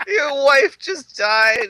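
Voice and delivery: slow, high mock yell of disbelief; mock outraged shout. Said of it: "I'm like".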